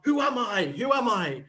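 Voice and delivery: lightly voiced bewildered film hero; Jackie Chan. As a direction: call it out like a lost movie hero. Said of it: "that concept of".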